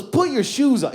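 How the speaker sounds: high, insistent command; exasperated order. Retold or imitated. Woman kicking him out, exasperated order. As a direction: imitate a fed-up woman giving orders.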